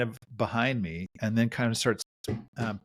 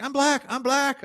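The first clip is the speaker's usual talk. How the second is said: high-pitched mock yell; short repeated outburst, pitched way up; imitated yelling; slower than usual. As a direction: imitate a wild man yelling it out. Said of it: "he starts yelling out".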